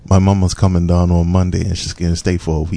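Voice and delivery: casual, run-together Bermudian; Bermudian accent. Shown as a demonstration. Guest translating the host's phrase, Bermudian accent. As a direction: reel it off in everyday Bermudian. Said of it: "give it to me in your best Bermudian accent".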